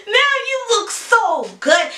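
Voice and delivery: high, loud excited yell; screaming out the window. Retold or imitated. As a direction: call out across the street, thrilled. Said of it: "I was screaming out the window".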